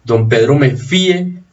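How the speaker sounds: accent the i in "fíe", then drop to e; "fíe" said FEE-eh; stress on the Í of fíe. Shown as a demonstration. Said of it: "con tilde".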